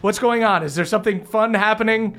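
a curious friend bounding over; Jaina's voice. Acted. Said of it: "Jaina rushes over real quick".

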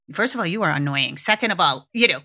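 normal talking voice

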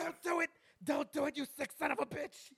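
high, frantic wrestling commentator plea; hammed-up ringside outrage; Jim Ross. Announcer begs an attacker to stop, mock frantic. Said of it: "Jim Ross".

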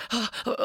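imitated panicked gasps; heavy gasping breaths. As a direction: breathe heavily as if it is all sinking in. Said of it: "like, breathing heavily".

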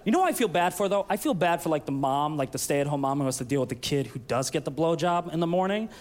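usual speaking voice

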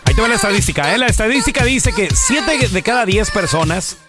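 ordinary talk, nothing marked

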